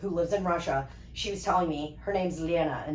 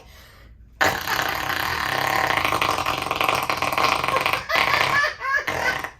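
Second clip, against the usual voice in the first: loud wet raspberry fart sound; imitated fart noise; 4 dB louder than usual. Imitating a farting subway passenger for her cousin with a loud raspberry.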